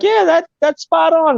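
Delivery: high excited crowd voice; bright high quoted agreement; excited listeners. Retold or imitated. Audience reaction quoted.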